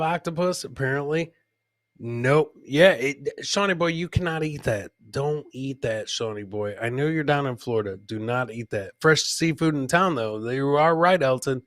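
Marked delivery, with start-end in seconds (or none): none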